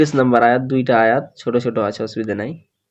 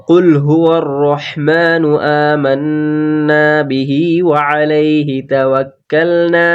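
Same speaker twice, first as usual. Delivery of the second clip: full verse recitation; slower than usual. Shown as a demonstration. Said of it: "তাহলে কি হলো?".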